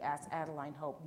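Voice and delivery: usual speaking voice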